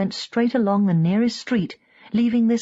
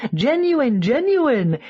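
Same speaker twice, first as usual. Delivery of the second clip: high, louder charming sales pitch; winning salesman; 6 semitones higher, 4 dB louder, slower than usual. A narrator's reading. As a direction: sell it with a charming grin. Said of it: "he says winningly".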